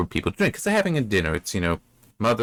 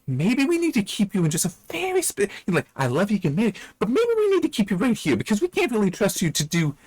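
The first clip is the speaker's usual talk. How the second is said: high-pitched, pleading diplomacy; mock placating; 11 semitones higher than usual. Hans telling Elsie she can't be trusted, mock placating. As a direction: break it to them in a strained high voice.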